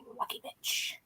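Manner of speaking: breathy, fully whispered jab; whispered envy. Real, not acted. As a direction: hiss it like a jealous secret. Envious aside to friend.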